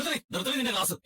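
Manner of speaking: angry cut-off. Acted. Husband cuts off wife's lecture.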